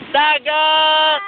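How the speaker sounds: long, held call of "dagat"; shouted demonstration. Shown as a demonstration. Parent counts child in, long call-out. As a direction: call it out and stretch it. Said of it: "Ah, lakas, ah".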